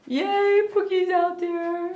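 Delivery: high, loud happy cry; squealing at Pookie; excited squeal. Real, not acted. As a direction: greet the puppy picture with joy. Spots puppy in gift.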